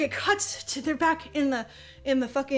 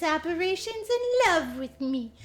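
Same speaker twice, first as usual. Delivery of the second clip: mock prissy heroine; 3 semitones higher, slower than usual. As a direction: sneer at her smug new confidence. Continues Lily impression.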